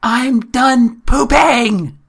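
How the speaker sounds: put-on high, loud, slow little-girl shout; imitating a three-year-old yelling; toddler yelling. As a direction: drag it out like a kid on the potty. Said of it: "my daughter yelling".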